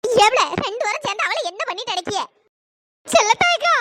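ordinary talk, nothing marked

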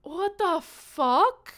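high, loud, drawn-out shock; shocked disbelief. Real, not acted. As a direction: stretch it out, loud and aghast. Still shocked by the demon bird.

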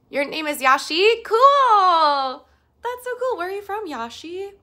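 high, loud, delighted exclaiming; big excited squeal, easing into a bright question; thrilled. Real, not acted. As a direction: gush with surprise at a lovely coincidence. Thrilled to meet viewer named Yashi.

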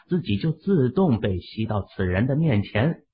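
ordinary delivery

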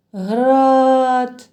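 "град" stretched with a long held "а"; sounding out. Shown as a demonstration. Teacher helps children find the third sound, sounding out "град". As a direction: hold the vowel in "град" long. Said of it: "Третій звук".

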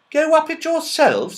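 high, loud horrified elf; in horror. Acted. Elf horrified that the children will climb, high loud. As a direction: gasp it out appalled. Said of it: "said Mister Whiskers in horror".

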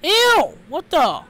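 high, loud grossed-out yelps; very high cries of shock; shrieked disgust. Real, not acted. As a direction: squeal in revolted disbelief. Repulsed by what appears.

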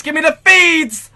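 high slow pleading yell; desperate shout. Real, not acted. Gamer close to a chem strike, begging for kill feeds. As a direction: beg the game loudly.

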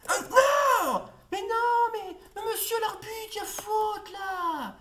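very high, loud shout at the referee; angry appeal to the ref; outraged protest. Real, not acted. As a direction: yell that it's a foul, indignant, drawn out.

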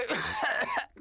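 dry sick cough. Acted. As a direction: cough weakly while ill. Clarence enfermo tose. (tos de enfermo)

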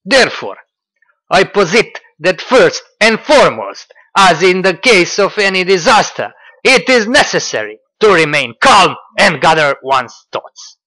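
loud, high mock-solemn dictation; pompous Russian-accented imitation, loud; mocking Dugin quote. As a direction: read Dugin like a grand decree. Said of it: "We continue".

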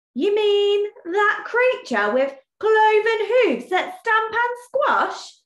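high, slow, trembling whimper; a shaking boar can barely get the words out. Acted. Boar answering Badger, high, slow whimper. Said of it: "whimpered the shaking Boar".

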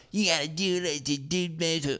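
high, mocking imitation of his date; mock girl voice. Mocking the date who lectures him on voting, high mimic. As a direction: pitch way up to mimic her. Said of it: "girl on a date".

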